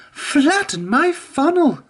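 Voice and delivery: high, slow, exasperated exclamation; steaming Emily. A narrator's reading. Emily frets at the blocked line, high and slow. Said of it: "steamed Emily".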